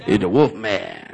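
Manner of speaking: low, slow radio drawl. Retold or imitated. Preacher announcing himself as the Wolfman. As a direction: croon it low like a late-night DJ. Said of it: "with the radio voice".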